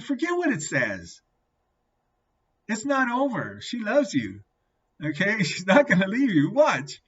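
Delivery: high, loud retold encouragement; acting out a fired-up friend; emphatic reassurance. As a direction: insist there is still hope. Friend insists she still loves him.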